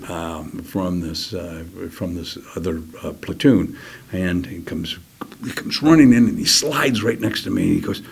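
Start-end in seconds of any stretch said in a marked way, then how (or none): none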